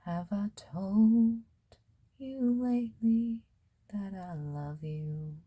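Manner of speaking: a hint of a spirit speaking from beyond; channeled spirit voice. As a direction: lightly voice the spirit's tender question. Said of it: "Now I'm hearing".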